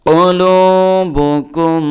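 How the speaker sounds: কুলুবুকুম chanted slowly, high, final ম hummed; recited with ghunna. Shown as a demonstration. Teacher demonstrates the word for students, slow chanted recitation. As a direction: draw out কুলুবুকুম like a reciter, humming the last m. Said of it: "নুনে মিমে তাসদিদ গুণা করা ওয়াজিব".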